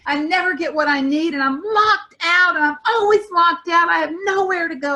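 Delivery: high, loud wail on the edge of tears; imagined teenager crying out that she's locked out; wailing self-pity. Retold or imitated. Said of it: "that part of her that was like".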